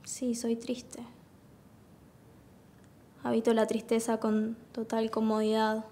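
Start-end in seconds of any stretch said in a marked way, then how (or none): 0.0-1.0 quiet admission. Acted. Candidate confessing sadness to her interviewer, quiet admission. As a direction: admit it softly, almost to yourself. Admits to interviewer she is sad.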